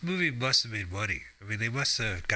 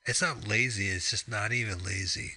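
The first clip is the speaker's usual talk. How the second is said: ordinary delivery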